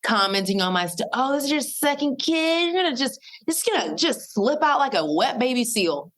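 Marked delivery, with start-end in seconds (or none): 1.1-6.1 high-pitched mimicry of breezy advice; mocking commenters. Mom mocking online commenters' birth predictions, high-pitched mockery. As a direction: parrot them in a higher, mocking voice.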